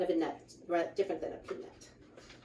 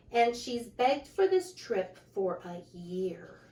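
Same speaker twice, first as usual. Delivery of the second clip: lively, sing-song picture book reading; reading to children; 4 semitones higher, 8 dB louder than usual. A narrator's reading. Reading a rhyming book aloud to children, lively. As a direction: read the rhymes out brightly to the kids.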